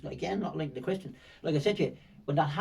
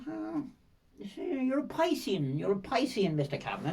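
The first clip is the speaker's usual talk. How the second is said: knowing stranger; 7 semitones higher than usual. Retold or imitated. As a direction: play him as the man who has worked it out. Voicing the stranger's realisation.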